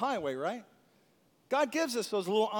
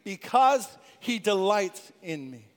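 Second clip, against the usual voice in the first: with conviction; 6 dB louder than usual. Shown as a demonstration. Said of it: "say it with conviction of our heart".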